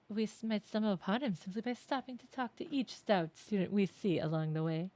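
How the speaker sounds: usual speaking voice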